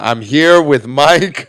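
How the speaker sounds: very slow, drawn-out announcement; mock announcing. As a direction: announce it with every word stretched out. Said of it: "Just announcing is like".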